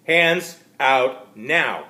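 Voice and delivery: slow, loud, firm command; demanding. Acted. Detective ordering the visitor at gunpoint, slow and loud. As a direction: spell out each word at gunpoint. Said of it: "I demanded".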